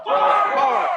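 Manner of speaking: big shouted echo. Acted. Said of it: "I gotta sing this one bigger".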